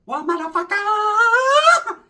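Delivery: high, slow, stretched-out challenge; exaggerated high-pitched tough-guy dare. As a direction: drag out a high mocking taunt.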